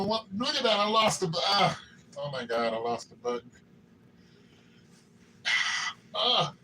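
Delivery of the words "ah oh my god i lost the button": usual speaking voice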